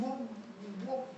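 baffled professor. Retold or imitated.

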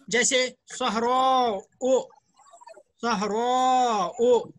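सहरा ओ stretched slowly with the final ओ held; drawn-out demo. Shown as a demonstration. Teacher drawing out the ending for students, slowly. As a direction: spell out the alif mamduda slowly. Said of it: "अलिफ ममदूदा".

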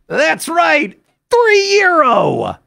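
very high, stretched-out price; mock announcer hype. Teller mocking hotel nickel and diming, high drawn-out hype. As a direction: crow the absurd price slowly and high.

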